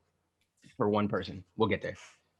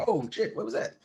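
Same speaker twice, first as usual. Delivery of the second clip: startled; 8 semitones higher than usual. Retold or imitated. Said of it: "they got a little".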